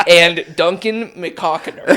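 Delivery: slow, words through laughter; fighting back laughter; laughing while reading. Real, not acted.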